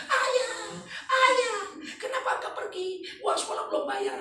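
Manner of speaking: high-pitched hammed-up crying out; mock wailing widow. Imitating a wife over her dead husband, mock wailing. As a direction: wail like a comically grieving wife. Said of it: "Istrinya nangis, teriak-teriak".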